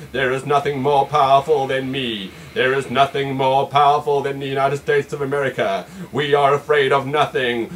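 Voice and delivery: deep booming mock leader; pompous president. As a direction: boom it out like an almighty ruler. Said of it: "he'll say".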